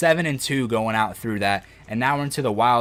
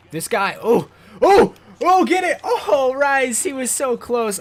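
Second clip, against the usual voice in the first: high excited shouts at the game; excited yelling; 10 semitones higher, 7 dB louder, slower than usual. Real, not acted. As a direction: erupt with excitement over a play. Big play on screen.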